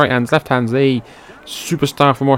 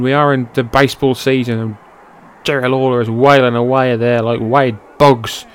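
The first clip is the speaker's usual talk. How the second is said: ordinary delivery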